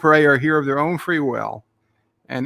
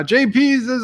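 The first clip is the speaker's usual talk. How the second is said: usual speaking voice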